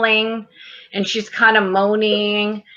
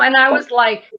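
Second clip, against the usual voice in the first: usual speaking voice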